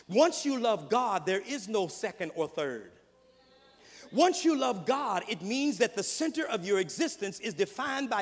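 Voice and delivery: loud, rising preacher's cadence; full-force preaching. Real, not acted. Preacher pressing the point on the congregation, full force. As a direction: drive it home from the pulpit.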